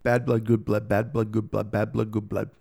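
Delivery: lightly performed tongue twister drill. Shown as a demonstration. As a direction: rattle through the twisters as a mouth warm-up. Tongue twister warm-ups.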